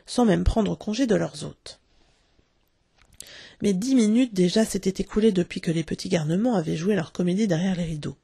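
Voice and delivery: usual speaking voice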